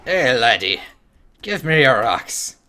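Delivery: put-on Scottish accent, demanding the rocks. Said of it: "Scottish, yeah, it is".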